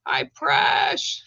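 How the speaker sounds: usual speaking voice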